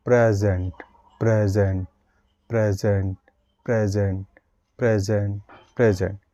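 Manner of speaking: soft, low drill of the word; light pressure. Shown as a demonstration. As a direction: glide through प्रेजेंट gently. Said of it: "उसमें पूरा word बोलोगे".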